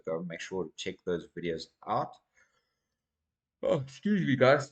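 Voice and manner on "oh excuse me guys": yawning. Real, not acted. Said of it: "Yes, I yawn a lot".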